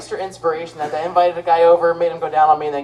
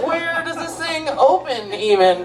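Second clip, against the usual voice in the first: higher, slow baffled man; confused guy voice; 7 semitones higher, slower than usual. Retold or imitated. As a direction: puzzle over it like a lost guy. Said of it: "You're gonna be like".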